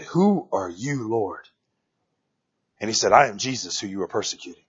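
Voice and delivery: very low, soft quoted exchange; hushed. Retold or imitated. Preacher retells Paul questioning the Lord, hushed.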